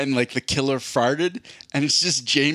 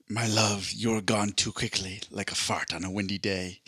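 put-on failed Irish accent; 3 semitones lower, 6 dB quieter than usual. Joyce reading tenderly to his lover, failed Irish accent. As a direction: murmur it like a bad Irish love letter. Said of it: "I can't even do an Irish accent".

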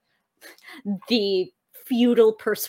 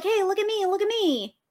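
bright high sing-song hey look at me; high call for attention; 8 semitones higher than usual. Shown as a demonstration. Butterflies calling to the player, high and sing-song. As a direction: call out high to catch their eye. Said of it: "they're like".

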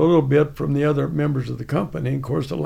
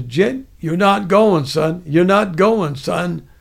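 imitated commanding sergeant, slowed down; first sergeant; 2 semitones higher, 5 dB louder, slower than usual. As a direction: lay down a flat refusal as a sergeant. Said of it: "that first sergeant says".

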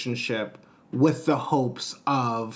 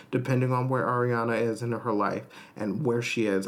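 ordinary talk, nothing marked

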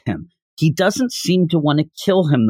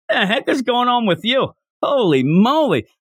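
higher-pitched mock disbelief; bewildered family member; 6 semitones higher than usual. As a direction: imitate a baffled wife confronting her husband. Wife and child confront Muzan.

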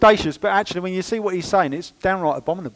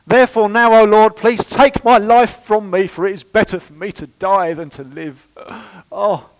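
hammed-up tragic plea, louder and slow; drama queen; 4 semitones higher, 7 dB louder than usual. Preacher mocking Jonah's plea to God, hammed-up drama queen. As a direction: play Jonah as a melodramatic drama queen begging. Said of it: "He turns into a right old drama queen".

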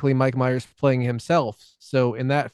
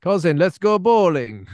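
Grand Theft Auto Russian, higher and slow; fake Russian accent; 5 semitones higher, 4 dB louder, slower than usual. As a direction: draw it out loudly in a bad American-made Russian accent. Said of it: "We're all Americans doing Russian accent".

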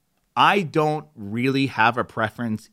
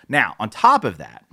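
ordinary talk

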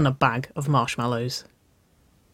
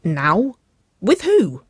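confused surprise; 6 semitones higher, 6 dB louder, much slower than usual. A narrator's reading. Sister learning of a date, confused and surprised. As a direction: pitch leaping up on the second question. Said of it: "her eyes widening in confusion".

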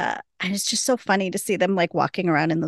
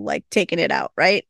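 ordinary delivery

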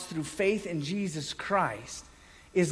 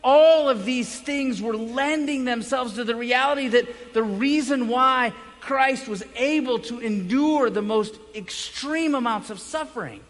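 ordinary delivery

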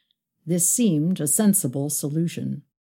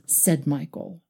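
ordinary delivery

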